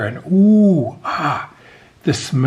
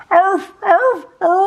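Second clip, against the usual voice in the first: high, loud wailing puppy barks; 6 dB louder, slower than usual. A narrator's reading. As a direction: wail out for her family. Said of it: "closed her eyes and wailed".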